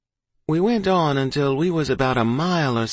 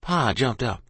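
usual speaking voice

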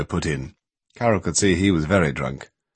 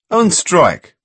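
firm confirmation; 10 semitones higher, 5 dB louder than usual. A narrator's reading. Paul confirms firmly.

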